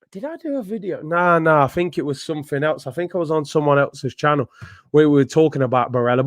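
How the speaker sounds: usual speaking voice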